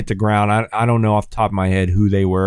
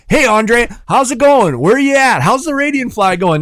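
pestering friends; 11 semitones higher, 4 dB louder than usual. Retold or imitated. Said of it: "They're like".

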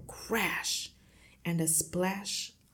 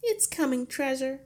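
high, raised call from another room; wifely sing-out, pitched up and louder; 8 semitones higher, 5 dB louder than usual. A narrator's reading. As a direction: call through to your husband in the next room. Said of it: "Missus Wormwood called from the kitchen".

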